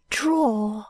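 put-on a rambling old woman clinging to hope; old Miss Flite.

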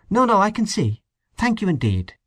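smooth polite refusal; velvety voice. A narrator's reading. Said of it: "velvety voice".

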